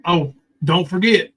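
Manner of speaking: ordinary delivery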